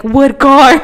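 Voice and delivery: mock surprised. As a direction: bark it back in disbelief. Boyfriend's retold reply on phone.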